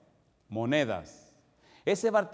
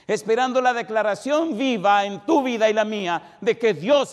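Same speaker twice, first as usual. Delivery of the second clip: loud, raised-pitch sermon delivery; full-force preaching; 8 semitones higher, 8 dB louder than usual. Real, not acted. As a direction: drive each question home to the congregation, forcefully. Predica a toda fuerza. (predicación enérgica a toda voz)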